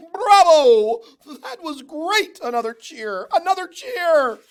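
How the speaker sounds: high loud cheering; excited crowd. A narrator's reading. Crowd on its feet cheering, high and loud. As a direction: shout it like a thrilled crowd.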